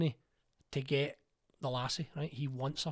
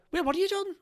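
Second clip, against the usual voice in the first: much higher, louder mock outrage; mock indignant call; 5 dB louder than usual. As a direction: throw the accusation back at him. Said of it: "and then I'm going".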